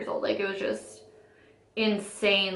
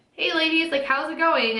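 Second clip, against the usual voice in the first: higher-pitched mock pick-up greeting; chummy bar guy; 6 semitones higher than usual. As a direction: imitate a guy sliding up to women. Guy approaching two women in bar.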